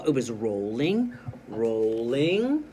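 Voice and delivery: ROLLING rising and left hanging each time; suspenseful stop. Shown as a demonstration. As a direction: let each ROLLING climb, then stop. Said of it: "then I stop for the dot".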